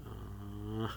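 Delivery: soft, slow-building villain chuckle; sinister chuckle. A narrator's reading. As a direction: chuckle to yourself with menace. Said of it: "voice grew sinister".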